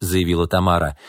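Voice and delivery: ordinary delivery, nothing marked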